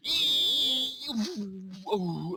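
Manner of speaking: high squealing ee then fading oohs, hammed up; mock squeals. Friend retelling the wife's funny scene, mock squealing. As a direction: squeak like a silly over-the-top face.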